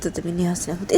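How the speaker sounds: ordinary delivery, nothing marked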